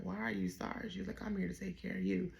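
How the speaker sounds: a hint of a kind nurse comforting her patient; gentle reassurance. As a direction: soothe him like a caring nurse.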